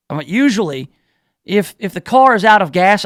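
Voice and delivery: ordinary delivery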